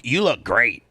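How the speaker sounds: ordinary talk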